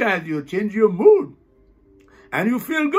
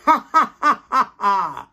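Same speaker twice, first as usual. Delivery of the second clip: staccato laugh falling away; demonstrated namaste laugh; 3 dB louder than usual. Shown as a demonstration. Teacher demonstrates namaste and laugh, staccato forced laughter. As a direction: pump out even ha-ha laughs. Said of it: "Namaste and laugh".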